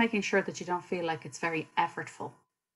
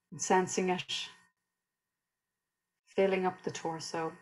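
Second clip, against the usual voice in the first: guided meditation; 5 dB quieter than usual. Real, not acted. Teacher leading listeners through a body scan, calm guided meditation. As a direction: guide them gently through the body, unhurried.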